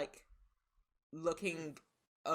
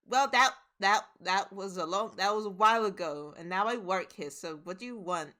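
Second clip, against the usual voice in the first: pouting defensive; 3 semitones higher, 9 dB louder than usual. Acted. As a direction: snap back, sulking. Pouting barmaid defends herself.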